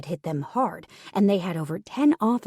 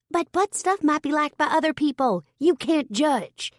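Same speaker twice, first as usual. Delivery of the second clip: higher, deadpan coworker voice; Turner's voice; 9 semitones higher, 3 dB louder than usual. A narrator's reading. Turner startling everyone with a blunt remark, high deadpan. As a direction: say it straight-faced and high.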